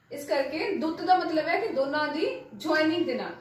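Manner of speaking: ordinary talk, nothing marked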